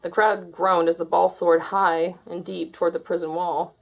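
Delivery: ordinary delivery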